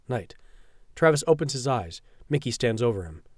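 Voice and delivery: ordinary delivery, nothing marked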